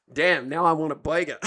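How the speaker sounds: ordinary delivery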